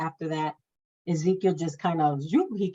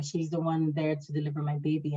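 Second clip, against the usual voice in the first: ordinary talk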